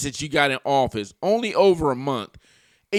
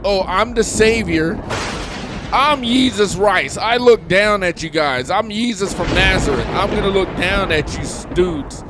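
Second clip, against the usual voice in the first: mock savior, raised and louder; Donald Trump; 5 semitones higher, 4 dB louder than usual. Vlogger mocking Trump looking down at people, grandiose imitation. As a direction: proclaim it like a self-anointed savior, mockingly. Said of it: "Trump's putting it out like".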